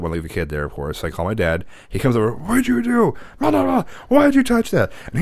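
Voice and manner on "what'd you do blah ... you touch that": high-pitched imitation of a panicked, scolding dad; mock frantic scolding; freaking-out dad. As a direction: mimic dad demanding answers, voice way up. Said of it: "he's freaking out".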